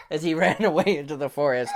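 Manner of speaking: usual speaking voice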